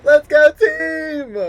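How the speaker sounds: high, loud triumphant shout; cheering. Real, not acted. Streamer cheering her team's win, loud and thrilled. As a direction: yell it out, thrilled the team won.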